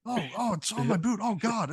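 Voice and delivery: high, frantic yelp of a guard whose boot is on fire; shrieking guard stamping at a burning boot; panicked guard. Acted.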